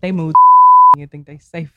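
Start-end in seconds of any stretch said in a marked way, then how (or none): 0.4-0.9 high shrieking laugh, much louder; sudden shrieking laugh at the sirens; loud burst of laughter. Real, not acted. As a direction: explode into a squealing laugh.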